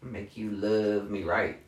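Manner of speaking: low, slow seductive purr; sultry dominatrix. Pretend. Dominatrix persona line.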